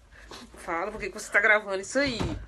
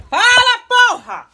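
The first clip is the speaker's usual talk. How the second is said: high, loud screaming demand; furious scream; 13 semitones higher, 19 dB louder, much slower than usual. Real, not acted. Mom screams at the son who won't answer. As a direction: scream it at full force.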